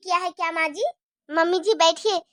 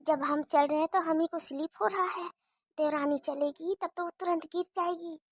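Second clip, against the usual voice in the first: much softer, low sneaky muttering; conspiratorial aside; 6 semitones lower, 9 dB quieter than usual. Acted. Bahu plots to herself about the spilt oil, softer and lower. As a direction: plot under your breath. Plotting aside before mother-in-law.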